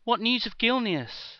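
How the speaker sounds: high, loud desperate cry; anxious pleading. Acted. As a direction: beg for news already dreading it. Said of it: "the anxiety in her voice betrayed".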